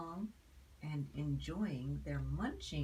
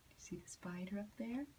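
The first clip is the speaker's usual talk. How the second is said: whispered aside; 3 semitones higher, 6 dB quieter, much faster than usual. Real, not acted. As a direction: lean in and whisper fast. Aside to child about picture.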